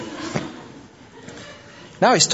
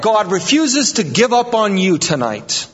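fiery preacher driving the point home; preaching at full force; 7 semitones higher than usual. Acted. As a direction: preach it out, rising to a peak. Pastor building to preaching peak.

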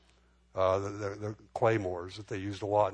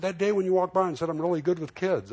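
higher, louder, faster mocking echo of the compliment; retold angry accusation; 8 semitones higher, 4 dB louder than usual. Angry churchgoer quoting pastor's compliment back, sharp mock echo.

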